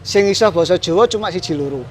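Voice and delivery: usual speaking voice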